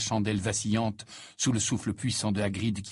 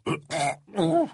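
put-on very high, tipsy, flustered old man; flustered Slughorn. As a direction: stammer out horrified agreement in a high, wailing voice.

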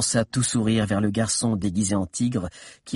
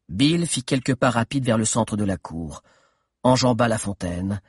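ordinary talk, nothing marked

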